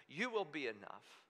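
soft and low, settled conviction; quoting Habakkuk softly to God; quiet resolve. Retold or imitated. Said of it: "he says".

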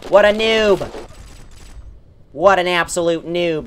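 slow, drawn-out jeer; mocking taunt. Gamer jeering at an enemy stuck in spawn, slow. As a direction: sneer at the stuck player.

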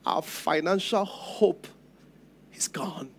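slow, soft words fading to a whisper on the last; hushed grief. Acted. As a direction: let the hope die as you say it, slowly. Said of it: "She was saying".

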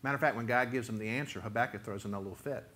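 normal talking voice

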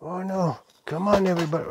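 normal talking voice

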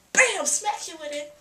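loud, high "Bam" then easing off; mock battle cry. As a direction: punch it out like a playful action move. Acts out Neville striking.

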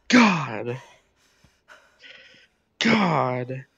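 ordinary talk, nothing marked

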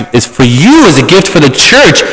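normal talking voice

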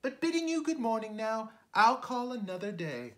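very high, loud little fly protest. Acted. Fly refusing the spider's bed, high frightened squeak.

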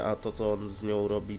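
normal talking voice

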